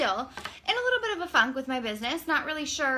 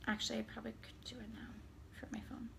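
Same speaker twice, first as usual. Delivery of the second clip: drops to a near whisper; quiet aside; 16 dB quieter, faster than usual. Real, not acted. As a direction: mutter it under your breath. Quiet aside about her phone.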